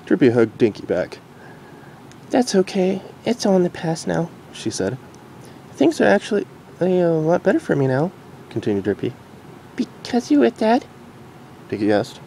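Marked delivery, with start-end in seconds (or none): 2.3-8.1 higher, gentle mare voice; reassuring Derpy. Acted. Derpy hugging Dinky, gently reassuring. 9.8-10.9 very high filly voice; curious filly. Acted. Dinky asking her mom, curious filly.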